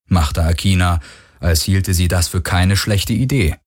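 usual speaking voice

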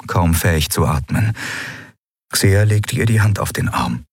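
ordinary delivery, nothing marked

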